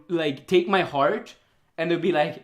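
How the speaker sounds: ordinary delivery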